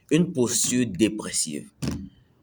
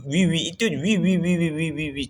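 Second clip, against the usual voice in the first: higher rapid string of eager yeses; eager yes-sayer; 6 semitones higher than usual. Retold or imitated. Vlogger imitating a friend who always agrees, high quick yeses. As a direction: rattle off yes after yes, pitched up. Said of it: "Tu dis toujours oui".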